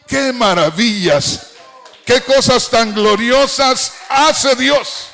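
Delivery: slow, high exclamation of praise; preacher proclaiming God's glory; exultant preaching. Real, not acted. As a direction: draw out each word in loud wonder.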